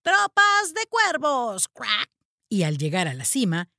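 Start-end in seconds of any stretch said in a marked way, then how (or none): 0.0-2.1 crow call. Acted. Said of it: "Teddy lanzó un graznido suave".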